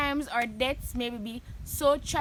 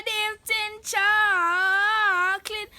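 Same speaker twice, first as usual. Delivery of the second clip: drawn-out name call; 7 semitones higher, 3 dB louder, much slower than usual. Acted. Vlogger drawls her channel name to her fans. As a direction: stretch the channel name out slow and high.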